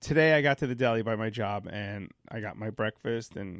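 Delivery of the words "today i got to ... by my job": usual speaking voice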